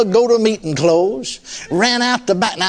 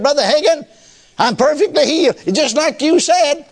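a hint of a joyful woman, higher, slowing at the end; lightly voiced excited lady; 8 semitones higher than usual. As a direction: call out happily down the aisle, higher voice. Healed lady calling out in church.

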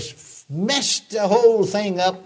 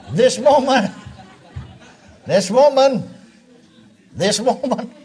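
high, loud whiny blame, laughing; mocking Adam; 8 semitones higher, 5 dB louder than usual. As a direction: mimic Adam pointing at the woman. Mocking Adam blaming the woman.

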